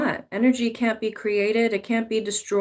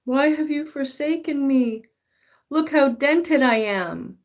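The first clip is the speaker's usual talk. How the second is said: whining gold nugget; 6 semitones higher than usual. Acted. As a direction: wail your dents dramatically up to God. Gold nugget lamenting to God.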